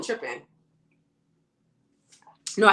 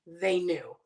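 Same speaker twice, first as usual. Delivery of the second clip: ordinary delivery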